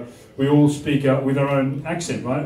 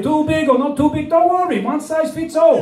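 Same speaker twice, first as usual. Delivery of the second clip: put-on much higher Chinese-accented caricature; mock Chinese accent; 10 semitones higher than usual. Chinese Shakespeare reassuring a customer, high Chinese accent. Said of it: "have a Chinese Shakespeare".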